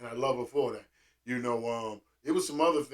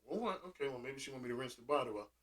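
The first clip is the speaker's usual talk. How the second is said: softer, fast thinking to himself; inner thought; 4 semitones lower, 16 dB quieter, faster than usual. Retold or imitated. Kid puzzling over mom's order, soft. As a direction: mumble it like a private thought. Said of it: "I'm like".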